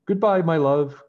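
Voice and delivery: loud, high farewell cry from the queen; a mother crying goodbye as her son leaves; tearful cry. A narrator's reading. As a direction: call out goodbye, near tears. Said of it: "cried the queen".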